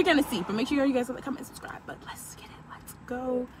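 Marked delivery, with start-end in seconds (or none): 1.9-3.5 breathy whisper, hyped under the breath; hushed let's go; whispered hype. Real, not acted. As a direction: whisper it like a secret pep talk. Hyping self up after outro.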